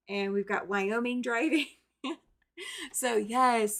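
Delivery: usual speaking voice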